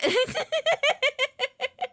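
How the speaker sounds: loud, high-pitched staccato giggle; giggling fit. Real, not acted. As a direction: burst into helpless high giggles. Cracks up over a joke.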